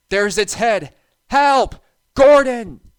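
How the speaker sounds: high, loud cry for help; panicked cry. A narrator's reading. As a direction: scream for help in terror. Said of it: "Thomas whispered".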